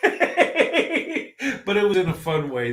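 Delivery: talking through a laugh, slowly. Real, not acted. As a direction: laugh the words out, amused. Laughing while telling about teasing.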